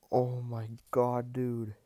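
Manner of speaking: ordinary talk, nothing marked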